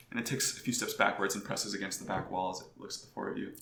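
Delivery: ordinary talk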